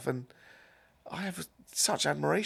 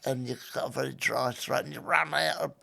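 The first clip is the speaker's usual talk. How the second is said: lightly voiced old Cockney man running out of breath; a dry-throated old Cockney, rising at the end; a hint of a wheezy old Cockney; 3 semitones higher than usual. Shown as a demonstration. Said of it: "I could sort of recreate quite faithfully".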